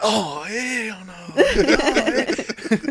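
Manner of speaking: loud, high, long-drawn comic refusal; mock horrified refusal. Imitating a friend turning down marriage, mock horrified refusal.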